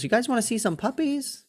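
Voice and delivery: lightly mimicked high, sing-song offer; enticing kids. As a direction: imitate a mom teasing children with a treat. Woman offers puppies to the girls.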